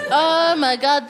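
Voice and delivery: normal talking voice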